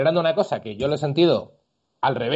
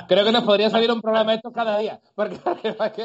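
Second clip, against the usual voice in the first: laughing; 6 semitones higher, faster than usual. Real, not acted. Joking with co-hosts after laughing.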